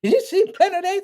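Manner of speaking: high, fast hammed-up scolding voice; mock scolding elder. Comic retelling of Gregory grilling him after the show, hammed-up high scold. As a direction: squeak it out like a cranky old lecturer.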